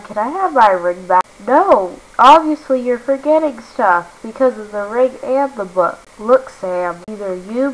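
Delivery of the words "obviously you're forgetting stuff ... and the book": normal talking voice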